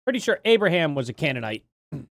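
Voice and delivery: normal talking voice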